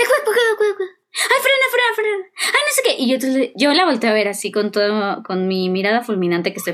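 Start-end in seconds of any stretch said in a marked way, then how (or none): 0.0-3.0 much higher, mock-panicked cries of cuidado and frena; panicky mother. Mother panicking at her daughter's driving, mock-frantic high cries. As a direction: play the nervous mom bracing in the passenger seat. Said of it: "va y me dice".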